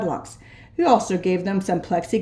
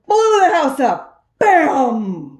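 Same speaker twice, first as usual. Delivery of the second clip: higher, louder dramatic bang; explosive; 6 semitones higher, 5 dB louder, much slower than usual. A narrator's reading. Narrator making the dynamite blast land, loud. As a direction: make the blast burst out.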